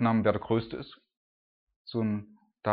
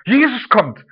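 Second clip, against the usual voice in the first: high, loud excited shout; excited call; 11 semitones higher, 12 dB louder, slower than usual. Acted. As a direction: announce it like the crowd spotting him. Crowd excitement.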